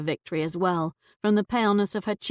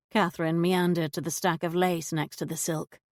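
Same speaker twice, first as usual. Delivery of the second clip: usual speaking voice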